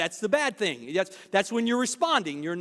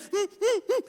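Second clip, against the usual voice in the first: high squeaky giggle; 12 semitones higher than usual. Retold or imitated. Listener bursting to talk.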